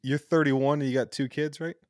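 ordinary talk

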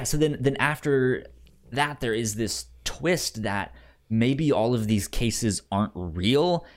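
ordinary delivery

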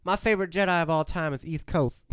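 "East Coast" as "iθ kʰoʊθ", Jedi lisped; lisped line. Shown as a demonstration. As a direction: lisp through "East Coast". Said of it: "Sorry to anyone that has a lisp".

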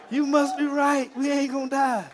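loud, high-pitched quoted cry; excited exclamation. Retold or imitated. Troop to sergeant after surviving mortar, loud excited cry. Repeats troop's realisation emphatically.

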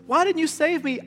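high-pitched complaint; comic protest, pitched well up; mock indignant. Castaway confronts God in heaven, mock indignant. Dead man challenges God.

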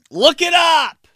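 high, loud shrill mom; mimicking his mom shouting at him to look it up; mock mom yell.

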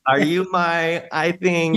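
child greeting an elder, lightly voiced and unsure; unsure kid. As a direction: ask it like a kid unsure what to call a relative. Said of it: "I would trip up a lot".

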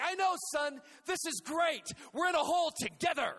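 dad shouting with delight from a hole; cheerful dad shouting. Retold or imitated. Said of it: "He's like".

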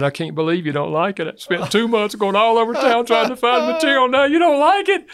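imitating an upset wife, rising to a high, loud wail; tearful mock wail. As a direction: build from hurt disbelief to a wailing, comic outburst. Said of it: "she broke into tears".

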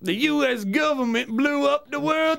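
high-pitched mock conspiracy cry; hammed-up conspiracy claim; mock conspiracy theorist. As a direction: put on a silly conspiracy-nut voice. Mock conspiracy rant in a bit.

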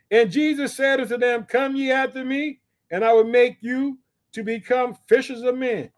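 high, forceful verse reading; scripture declamation. Acted. Preacher reads Jesus calling the fishers, high declamation. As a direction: proclaim it with pitch held high.

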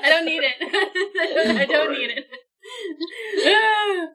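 high, laughing protest. Real, not acted. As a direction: giggle through it. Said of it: "take inspiration".

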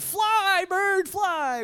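high, loud, stretched-out cry; dramatic mock yell to the heavens; shouted command. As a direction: urge a bird into the sky. Acts out flinging a bird skyward.

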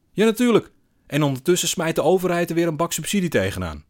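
loud, high-pitched outrage; indignant rant. A narrator's reading. Man ranting about government subsidy, indignant. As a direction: burst out in scornful protest.

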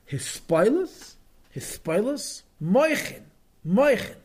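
"his spoilers" and "moichin" each swept up high at the end; high rising sing-song on "his spoilers" and "moichin"; sing-song Rebbe's intonation. Retold or imitated. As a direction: chant each word as a rising, drawn-out question. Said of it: "the music of these words".

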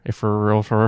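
"realtor" slurred to "rilfer"; slurring words. Real, not acted. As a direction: slur "realtor" sloppily. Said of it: "I'm slurring my words".

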